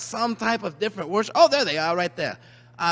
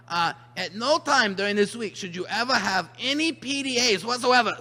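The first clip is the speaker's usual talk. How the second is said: usual speaking voice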